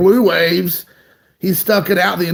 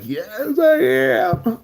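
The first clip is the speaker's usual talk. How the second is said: rising to a much higher pitch; mock confession; 7 semitones higher, much slower than usual. The host admitting he cries over a baseball player, in a mock voice that jumps up high. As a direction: end high and squeaky. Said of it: "It's like".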